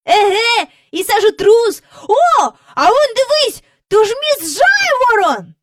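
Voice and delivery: high, loud exclamations of discovery; excited child. A narrator's reading. Child spots neighbours flying with balloons, exclaiming excitedly. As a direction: pipe up high, pointing out each find. Children spotting people in the air.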